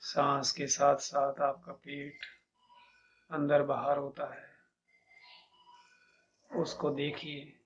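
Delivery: measured meditation guide, long pauses between instructions; guided meditation. Acted. Teacher leading listeners to feel each body part, calm meditation guidance.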